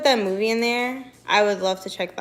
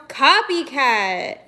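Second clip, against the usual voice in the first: slow, high playful accusation; teasing drawl; 4 semitones higher, 7 dB louder, much slower than usual. Real, not acted. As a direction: draw it out as a tease. Teasing a viewer.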